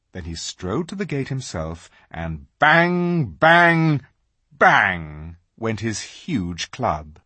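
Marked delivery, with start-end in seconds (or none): none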